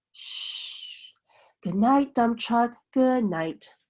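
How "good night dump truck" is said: plain reading voice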